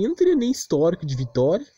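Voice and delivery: ordinary talk, nothing marked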